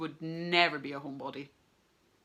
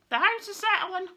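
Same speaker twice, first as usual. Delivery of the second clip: a mother's voice, pitched much higher and louder; mum; 13 semitones higher, 7 dB louder than usual. Retold or imitated. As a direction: mimic mum calmly explaining away the noise. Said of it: "my mum said".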